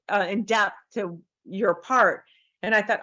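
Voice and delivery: usual speaking voice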